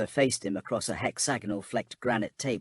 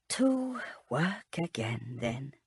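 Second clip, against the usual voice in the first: slow, weary crone mutter; 4 semitones higher, 3 dB quieter, slower than usual. A narrator's reading. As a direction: grumble it to herself like an old woman. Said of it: "she muttered".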